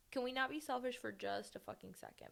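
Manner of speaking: ordinary talk, nothing marked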